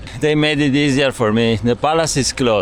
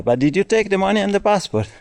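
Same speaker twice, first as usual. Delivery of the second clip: quoting the guide; 8 semitones higher, faster than usual. Retold or imitated. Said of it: "And he said".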